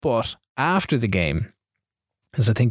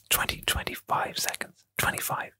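creepy breathless whisper; hushed, fully whispered aside; 10 dB quieter than usual. Real, not acted. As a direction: whisper it close into the listener's ear. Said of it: "get really fucking creeped out when [name] starts whispering".

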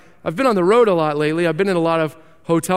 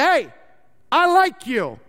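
higher, odd voice of a strange stranger; odd street stranger; 5 semitones higher than usual. Retold or imitated. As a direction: play the oddball stranger who takes a liking to you. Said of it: "he looks at me and he says".

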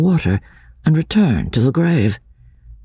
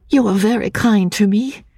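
higher, gentle woman's voice, murmured; timid woman murmuring; 9 semitones higher than usual. A narrator's reading. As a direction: murmur it like a frightened young woman. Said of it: "she murmured".

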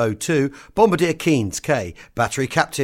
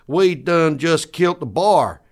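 slow, higher hillbilly twang; 3 semitones higher, 3 dB louder than usual. Acted. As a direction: boast like a backwoods hunter. Hillbilly sketch dialogue.

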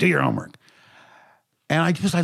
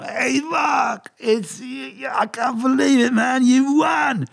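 put-on drunken producer; 11 semitones higher, slower than usual. Drunk producer shouting news down the phone, slurred. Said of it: "I hear a very drunken producer going".

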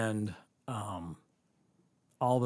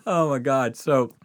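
usual speaking voice

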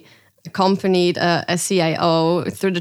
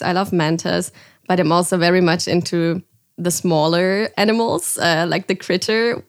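ordinary talk

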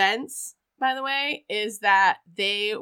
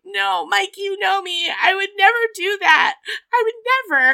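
high, whiny protest of innocence; hammed-up tearful denial; mock pleading wife; 10 semitones higher, 5 dB louder than usual. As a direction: beg him to believe you, voice pitched way up. Wife pleads innocence on phone, retold.

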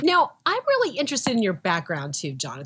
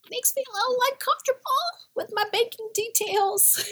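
uneasy squeak. Real, not acted. Customer admitting discomfort with banking apps, uneasy high squeak. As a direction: squeeze it up high, ending in a laugh.

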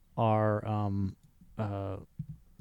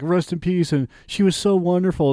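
mock gushing mourner; 7 semitones higher, 5 dB louder than usual. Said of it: "everybody's like".

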